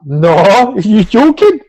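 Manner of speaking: retold reply half-laughing with disbelief. Refusing the football invite, laughing in disbelief. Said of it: "I burst out laughing".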